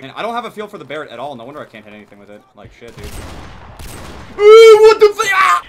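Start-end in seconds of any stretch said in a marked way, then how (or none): 3.1-5.6 very high, loud shock; real scream of surprise; startled scream. Real, not acted. Startled during game moment.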